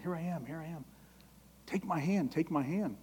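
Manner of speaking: soft tender plea; tender gentle call. Acted. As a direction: coax them gently to take your hand. God gently calling alongside you.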